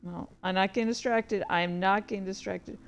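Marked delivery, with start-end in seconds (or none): none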